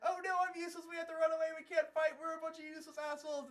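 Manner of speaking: high, loud, rattled-off complaint; mock whining.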